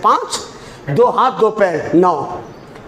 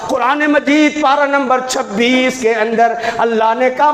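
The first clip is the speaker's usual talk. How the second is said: usual speaking voice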